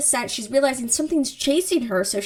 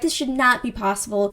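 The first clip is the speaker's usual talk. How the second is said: ordinary delivery, nothing marked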